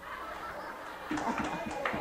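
soft breathy laughter. Real, not acted. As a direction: laugh quietly at the joke. Laughs after punchline.

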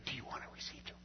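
hushed unvoiced whisper in church. Churchwoman leaning over to Bob during altar call, whispering. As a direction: lean in and whisper the question.

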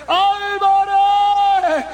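high, drawn-out cry of a name; calling out. Retold or imitated. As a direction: call out slowly, pitched well up. Pastor calls to dying man. (llamado agudo y alargado)

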